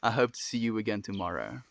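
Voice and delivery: ordinary talk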